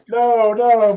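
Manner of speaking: ordinary delivery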